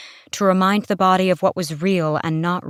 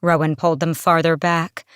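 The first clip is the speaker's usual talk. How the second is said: plain reading voice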